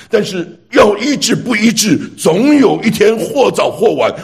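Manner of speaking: preacher warning the congregation of judgment, rising fervour; fervent preaching. Acted. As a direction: press the point home like a pulpit plea. Preacher rises to urgent appeal.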